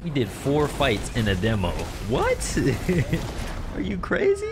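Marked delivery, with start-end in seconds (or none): 2.1-4.5 high squeaky shocked yelp; shocked disbelief. Real, not acted. Shocked at game moment.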